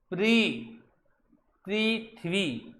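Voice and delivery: प्री held, then पृथ्वी drawn out as "pree-thvee"; syllable-by-syllable pronunciation. Shown as a demonstration. Teacher models how the word should sound, slow syllable-by-syllable pronunciation. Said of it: "उच्चारण ऐसा होगा".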